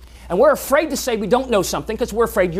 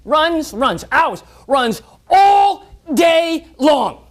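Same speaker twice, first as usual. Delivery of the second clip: louder, higher, stretched-out calling; building chase; 8 semitones higher, 6 dB louder, much slower than usual. Acted. Telling how the dog chased all day, loud and drawn out. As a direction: stretch the words like an endless chase.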